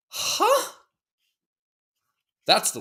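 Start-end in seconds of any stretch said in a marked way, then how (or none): 0.1-0.7 huge high-pitched disbelieving huh; shocked huh. Retold or imitated. Dad cut off by his wife, squeaking in disbelief.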